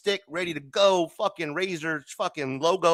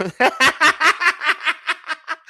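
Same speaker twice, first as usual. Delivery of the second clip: high, loud laughing fit; cracking up; 11 semitones higher, 6 dB louder than usual. Real, not acted. Host cracks up at his selfie-stick joke, loud laughter. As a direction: burst into real helpless laughter.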